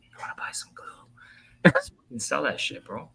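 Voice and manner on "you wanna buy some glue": slow whispered shady pitch; psst, back-alley dealer whisper; secretive raspy sales murmur. Retold or imitated. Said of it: "shady scalper bit".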